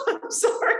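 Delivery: cracking up, high breathless giggle; laughing. Real, not acted. Acting coach joking with a student about scripted lines, laughing. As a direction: break into helpless laughter mid-line.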